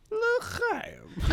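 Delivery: high, drawled Southern L'chaim; a hint of Southern drawl on L'chaim. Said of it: "going like".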